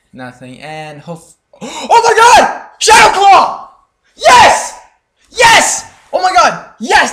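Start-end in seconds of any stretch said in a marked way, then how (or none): 1.6-7.1 very high, loud, shrieking joy; ecstatic screaming. Real, not acted. Gamer unboxing a rare weapon, ecstatic screaming. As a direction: scream with disbelieving delight at the drop. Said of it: "I'm so happy!".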